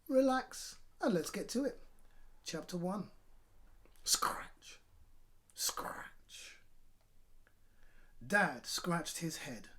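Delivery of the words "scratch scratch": breathy unvoiced scratch; whispered scratchy sound effect. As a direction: rasp it like fingernails on scalp.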